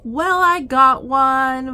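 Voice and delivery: loud, slow, drawn-out announcement; proud reveal. Real, not acted. Vlogger unveiling a long-awaited raccoon skull, proud. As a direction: savour the big reveal.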